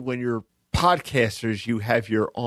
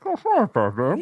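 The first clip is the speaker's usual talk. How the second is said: a muffled, masked villain, lightly done; a playful nod to Bane through a mask; Bane impression; 6 semitones higher than usual. Cohost mocks muffled audio as Bane greeting Batman. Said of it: "sounds like Bane from the last Batman movie".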